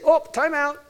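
high, loud mock call to the kids; calling time out. Grandpa stopping kids fighting.

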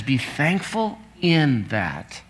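slow, with "in" drawn out; deliberate, slow emphasis on "in"; stress on in. Shown as a demonstration. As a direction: press "in" slowly in "Be thankful in that". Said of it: "but he says".

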